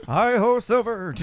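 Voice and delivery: hammed-up hero charging in; mock hero call.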